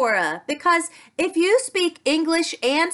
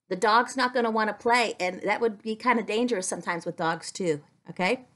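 gentle warning; 8 semitones lower, 6 dB quieter, much faster than usual. Real, not acted. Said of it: "gently warns child".

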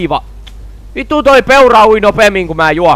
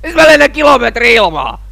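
loud, high exclamation; incredulous complaint; 6 semitones higher, 5 dB louder than usual. Real, not acted. Player thrown into the air, loud incredulous complaint. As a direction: raise the voice in disbelief.

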